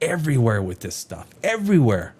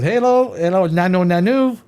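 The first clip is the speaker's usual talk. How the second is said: high, loud alien hello; hammed-up Robin Williams alien hello; Mork greeting; 4 semitones higher, 4 dB louder than usual. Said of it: "I said".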